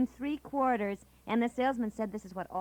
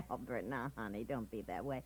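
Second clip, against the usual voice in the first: much softer and lower, soothing; soft coaxing; 13 semitones lower, 10 dB quieter than usual. Acted. Wife soothes her sulking husband, softly coaxing. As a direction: gently talk him out of sulking.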